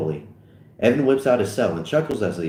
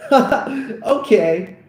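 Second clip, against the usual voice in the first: high laughing okay; cracking up; 11 semitones higher, 4 dB louder than usual. Real, not acted. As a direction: break into laughter mid-read. Reader cracks up mid-read.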